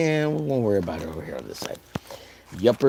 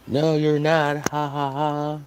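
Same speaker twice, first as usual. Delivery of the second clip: mock taunt; 4 semitones higher, 3 dB louder, slower than usual. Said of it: "he says".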